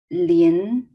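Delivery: LIN drawn out and rising, loud; second tone. Shown as a demonstration. Teacher modelling the other name, rising LIN. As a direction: glide upward through LIN. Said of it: "second tone is rising tone".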